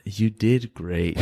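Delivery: slow, drawn-out knowing praise with a laugh in it; condescending praise. Retold or imitated. The rival in the elevator, slowly and condescendingly praising Andreas. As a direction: lay the fake praise on slowly, half-laughing. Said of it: "was that snarky".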